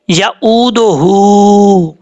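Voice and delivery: very slow यऊदुहु with a long hu; tajweed chanting; slow recitation. Shown as a demonstration. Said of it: "दाल के बाद जो हा है".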